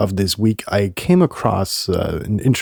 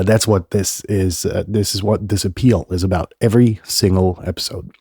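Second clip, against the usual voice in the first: ordinary delivery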